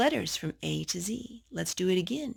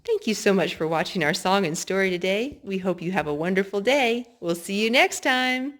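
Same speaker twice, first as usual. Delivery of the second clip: cheery sign-off; 6 dB louder than usual. Acted. Sign-off to viewers.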